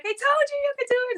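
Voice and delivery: high, fast, gushing mother's voice; mother cheering her daughter on; proud mom. Retold or imitated. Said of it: "She's like".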